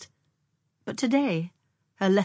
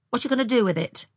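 higher, louder, mildly sharp asking; sharp question; 6 semitones higher, 5 dB louder, faster than usual. A narrator's reading. Frances questioning her sister about the mole, sharply. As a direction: put a sharp edge on the question. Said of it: "asked Frances sharply".

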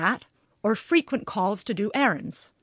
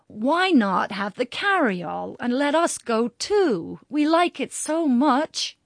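put-on higher, slow pleading child's tone; 5 semitones higher, 5 dB louder, slower than usual. A narrator's reading. Father pleading with his children to come along, high slow pleading. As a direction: beg sweetly, drawn out. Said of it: "in the pleading tone Harry often used".

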